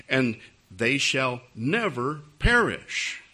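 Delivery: slow, weighty scripture quote; slow, deliberate verse reading. Acted. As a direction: spell out the verse slowly for a doubter. Reads the verse out in witnessing scene.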